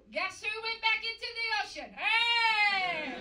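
high and loud, ending on a shout; big diva build. Acted. Diva builds to a loud high Titanic-style cry.

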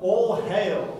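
loud, slow, grand "all hail"; mock solemn. Shown as a demonstration. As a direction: proclaim it like a herald. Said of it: "that sounds so holy".

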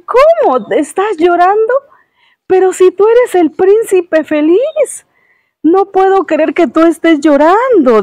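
high, loud cry of disbelief; astonished swallow. Acted. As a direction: gasp it out, unable to believe he weeps. Swallow finds the prince crying.

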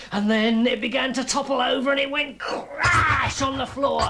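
rising dramatic storyteller; building to a crash. A narrator's reading. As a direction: build suspense then explode on the crash. Machine topples over.

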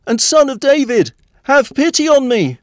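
high-pitched, loud cry for help; desperate shouting. A narrator's reading. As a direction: shout out a desperate plea for pity. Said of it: "came out shouting".

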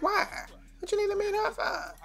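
high, indignant grandma asking why. Acted. Grandma questioning her grandson, high and suspicious. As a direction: squeak out a suspicious old lady's why. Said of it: "She like".

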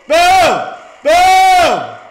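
booming, high-pitched yell; triumphant shout. Real, not acted. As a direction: shout each blast in celebration. Celebrating explosions in game.

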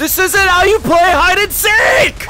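very high, slow shriek; furious scream. Acted. Seeker rages at boys leaving game, screaming. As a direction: scream it drawn out in outrage.

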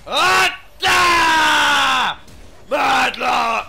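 yelling the champion name out loud; hyped, loud gamer yell; excited shout. Real, not acted. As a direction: belt it out like a battle cry. Hyped shout during play.

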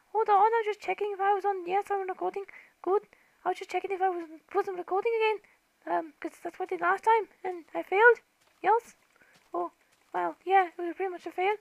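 put-on lightly held high, childish voice; high cartoon voice checking the recording; squeaky voice. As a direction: keep chatting in a silly squeak. Keeps the squeaky voice.

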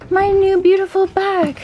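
very high, silly gushing voice; playful gushing. Pretend. Showing off her new Target bag, playful gushing. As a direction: coo it high in a hammed-up voice. Gushes over new bag, then repeats plainly.

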